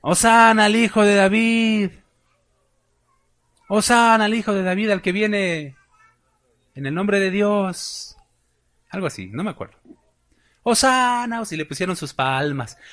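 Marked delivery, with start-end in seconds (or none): 0.0-8.2 higher, louder crowd acclaim; cheering crowd. Retold or imitated. Preacher acting the crowd hailing Jesus, higher louder acclaim. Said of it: "No le gritaban a Jesús". 10.6-11.4 high, loud hosanna cry; crowd cheer. Retold or imitated. Preacher acting the crowd's cheer, high and loud. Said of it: "le gritaban".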